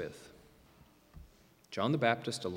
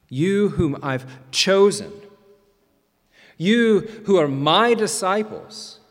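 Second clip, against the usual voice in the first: slow, louder, each word weighted; slow and emphatic; 2 semitones higher, 5 dB louder, slower than usual. Retold or imitated. Jesus appealing to his own disciples, slow and emphatic. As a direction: press each word slowly.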